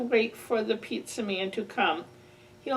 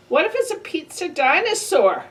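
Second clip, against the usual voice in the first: usual speaking voice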